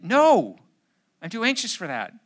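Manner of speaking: loud, high shout of no; sharp no. Acted. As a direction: bark a panicked no. Acted dialogue, anxious pastor snaps back.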